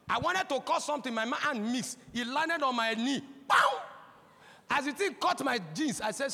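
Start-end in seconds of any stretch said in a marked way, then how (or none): 3.5-3.8 sound effect. Retold or imitated. Cutlass strikes the knee.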